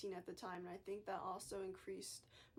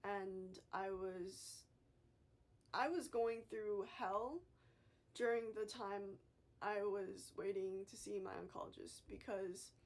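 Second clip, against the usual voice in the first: ordinary delivery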